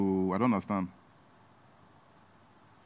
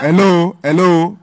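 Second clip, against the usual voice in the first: loud, raised-pitch interrupting call; loud, exasperated hello; calling to attention; 4 semitones higher, 11 dB louder, much slower than usual. Acted. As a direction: cut through the shouting. Breaking up the shouting.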